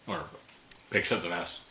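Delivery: ordinary delivery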